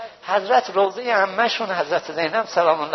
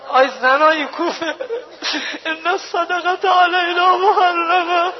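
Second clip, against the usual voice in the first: mild retold cry; 11 semitones higher, slower than usual. Zainab crying out to the women of Kufa, tearful high call. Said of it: "فریاد زد".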